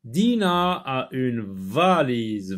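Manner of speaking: slow, stretched-out classroom reading; model reading aloud. Shown as a demonstration. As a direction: draw each word out for beginning readers. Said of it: "Lis les phrases".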